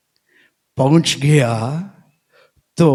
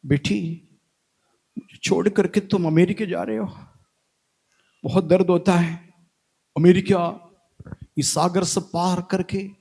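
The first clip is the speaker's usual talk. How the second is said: soft, mock-weeping plea; tearful father; 3 semitones higher, 5 dB quieter than usual. Father pleads with daughter leaving for America, softly tearful. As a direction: lightly act out a sorrowful father, voice held back. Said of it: "पापा आकर के रो रहा है".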